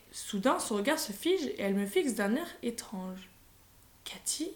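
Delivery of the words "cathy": much softer, rising Cathy?, barely voiced; whispered. A narrator's reading. Grandmother startled by her granddaughter's face, whisper. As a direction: breathe the name Cathy? as a question. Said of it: "chuchote-t-elle".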